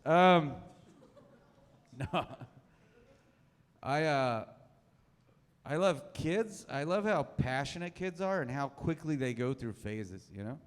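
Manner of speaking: ordinary talk, nothing marked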